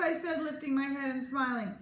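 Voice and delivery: ordinary delivery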